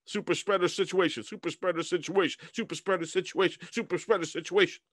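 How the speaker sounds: repeated in a quick even run; rapid tongue twister. Shown as a demonstration. Anchor showing off a tongue twister, rapid repetition. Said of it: "Try saying that three times fast".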